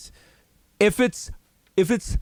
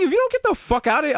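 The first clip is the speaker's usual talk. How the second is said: store clerk snapping; 9 semitones higher, faster than usual. Retold or imitated. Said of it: "They was like".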